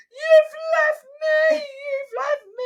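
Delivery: very high, stretched mock wail; slow, piercing child's complaint, imitated. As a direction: cry out like an abandoned small child. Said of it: "she's like".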